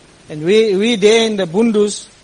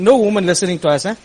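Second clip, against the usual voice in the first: ordinary delivery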